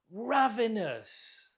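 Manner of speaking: RAV stressed high in ravenous, then falling; stress on first syllable. Shown as a demonstration. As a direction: model ravenous slowly, leaning on the first syllable. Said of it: "stress on the first syllable".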